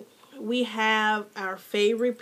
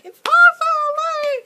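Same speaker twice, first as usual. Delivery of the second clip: very high, loud, slow squeal; ecstatic squeal; 8 dB louder than usual. Real, not acted. Vlogger thanks her favorite YouTuber with an ecstatic squeal. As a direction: squeal it with pure joy.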